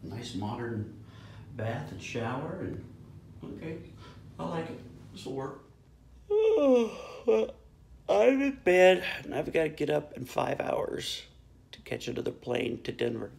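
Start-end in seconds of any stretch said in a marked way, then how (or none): 6.3-7.5 long sigh of relief; big tired sigh. Real, not acted. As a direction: exhale heavily into bed. Flopping into bed.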